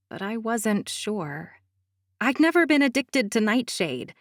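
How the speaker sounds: ordinary delivery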